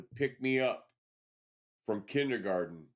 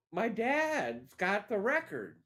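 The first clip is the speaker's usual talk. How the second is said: put-on high, slow child's reply; imitating a small boy; little kid voice; 9 semitones higher, slower than usual. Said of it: "And I go".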